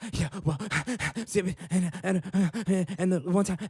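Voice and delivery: heavy sighing breaths between halting, loud words; mock mouth breather. Imitating a breathless mouth breather for viewers, heavy sighs. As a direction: huff through the stammered words. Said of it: "a mouth breather".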